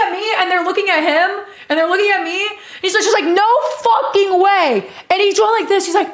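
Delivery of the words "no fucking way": high, slow, drawn-out amazement; starstruck, every word stretched; imitating stunned disbelief. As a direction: mimic a fan who can't believe it. Fan's disbelief acted again.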